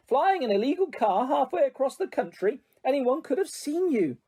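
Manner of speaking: high, exasperated reproach; exasperated scolding. Acted. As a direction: scold them, pitch rising. Mother scolding son.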